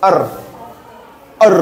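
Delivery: আর as a neutral 'er', for the spelling IR; isolated 'er' sound. Shown as a demonstration. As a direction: model আর as 'err', pausing between repeats. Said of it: "উচ্চারণ কেমন?".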